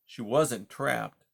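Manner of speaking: ordinary delivery, nothing marked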